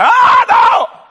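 high loud shouted no; wife refusing him, high loud shout; mock shouted refusal. Wife turning husband down, mock high shout.

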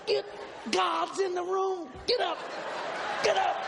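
husband yelling at his sleeping wife to get up; frantic yelling. Retold or imitated. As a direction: shout her awake in panic.

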